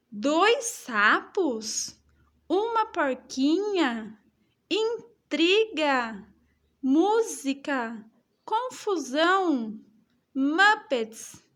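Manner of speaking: slow, punchy exclamation on every word; slow exclamatory reading, word by word; exclaiming each word. Shown as a demonstration. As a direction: read each word like it ends in an exclamation mark. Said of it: "Eu estou exclamando".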